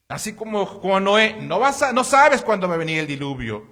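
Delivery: fuerte y alto, exhortando a la congregación; predicación a toda voz. Acted. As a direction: clama como pastor desde el púlpito. Predica con fuerza. (el pastor exhorta a la congregación, predicando a toda voz)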